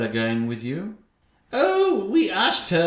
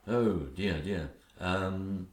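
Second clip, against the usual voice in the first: meek fretting; 7 semitones lower, 8 dB quieter, slower than usual. Acted. Henpecked king fretting, meek and soft. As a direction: lower and much softer worried murmur. Father cowed by wife.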